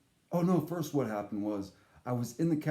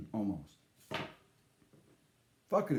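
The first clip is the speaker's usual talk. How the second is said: ordinary talk, nothing marked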